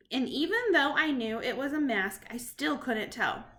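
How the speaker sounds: usual speaking voice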